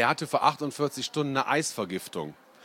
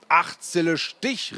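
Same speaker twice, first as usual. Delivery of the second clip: slow, higher, louder winning cry; triumphant call; 6 semitones higher, 4 dB louder, slower than usual. A narrator's reading. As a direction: slap down the winning card. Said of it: "rief ich". (der Junge ruft triumphierend, langsam und höher)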